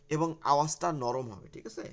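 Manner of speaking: usual speaking voice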